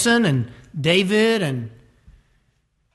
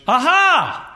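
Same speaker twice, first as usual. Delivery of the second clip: sudden realization; 7 semitones higher, 3 dB louder than usual. Acted. Acting out sudden epiphany.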